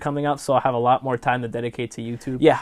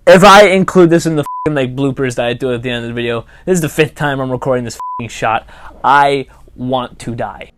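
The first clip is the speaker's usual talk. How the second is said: frustrated; 7 dB louder than usual. Real, not acted. Frustrated blooper outtake.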